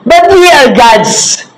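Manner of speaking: slow, high, ringing preacher's cry; preaching proclamation. Acted. Pastor proclaiming the psalm to his church, high and slow. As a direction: lift the verse up high and slow over the congregation.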